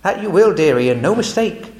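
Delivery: Missus Beaver. Acted. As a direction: tell the child straight, with relish. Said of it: "said Missus Beaver".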